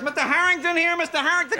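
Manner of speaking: high, searching call; imitated dad summoning the store owner; father calling out. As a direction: call for a shopkeeper by name. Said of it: "My father's looking for the guys".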